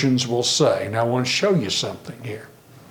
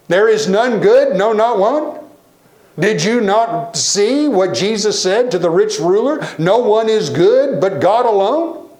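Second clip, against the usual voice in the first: raised, insistent preaching voice, louder and higher; emphatic rebuke; 6 semitones higher, 4 dB louder than usual. Retold or imitated. Young preacher rebuking the congregation, emphatic and raised. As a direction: hit it hard like a rookie preacher scolding the room. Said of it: "he goes".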